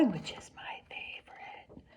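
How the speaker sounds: whispered aside. Real, not acted. Teacher whispering her secret to the class.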